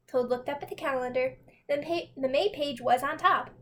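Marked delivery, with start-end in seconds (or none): none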